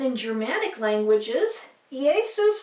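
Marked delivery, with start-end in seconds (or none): 1.9-2.6 Jesus said ee-AY-soos; "Jesus" opening on a vowel, ending soos; old Latin pronunciation. Shown as a demonstration. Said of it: "was written and pronounced for".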